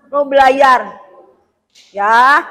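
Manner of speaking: ordinary talk, nothing marked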